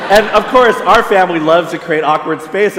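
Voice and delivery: normal talking voice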